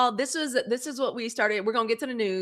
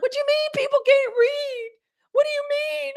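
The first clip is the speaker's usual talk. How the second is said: put-on much higher, loud distressed child's wail; upset child; 7 dB louder than usual. As a child learning people can't read, high distressed cry. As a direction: imitate her child self close to tears. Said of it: "I was crying".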